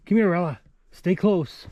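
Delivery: loud, urgent call to the dog. Real, not acted. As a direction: call her in close, a bit worried. Calling dog, coyotes near.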